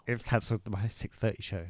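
normal talking voice

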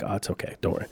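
whispered reassurance; calm him down under your breath. Retold or imitated. Hushed reassurance beside security.

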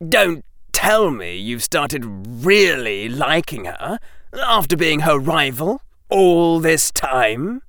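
put-on slow, lightly suspicious young boy; a note of suspicion. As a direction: probe him, slowly and doubtful. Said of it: "with just the right note of suspicion".

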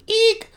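high, loud mock shriek; startled butler's eek. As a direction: squeal in cartoon fright. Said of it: "the butler goes".

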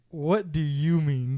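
slow, drawn-out disbelieving question; deliberate retort. Retold or imitated. Brother throwing the question back at his sister, deliberate. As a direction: space the words out in disbelief. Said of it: "And so then I went".

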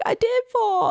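put-on high-pitched little boy voice; imitation of an upset kid; crying son. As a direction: mimic a child's wailed protest. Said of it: "he's like".

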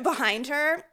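usual speaking voice

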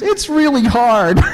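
put-on high, loud, drawn-out child's wail; mock a little girl on the verge of tears; almost crying. Daughter admits to babysitter it's hard, almost crying. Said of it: "almost starts to cry, and she says".

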